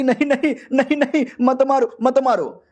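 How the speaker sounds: high, urgent repeated plea; acted pleading. Girl begs him to stop attacking.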